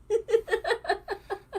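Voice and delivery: high cackling laugh. Real, not acted. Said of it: "Mom thinks I should stop cackling".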